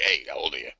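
low, quiet creep voice; joking low come-on; mock creepy guy. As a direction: drop it low and soft, pretending to be a creep. Acts Blackie as a creepy guy.

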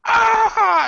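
high, loud shriek; mock terrified scream. Waking to a vampire at the window, mock scream. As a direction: scream in comic terror.

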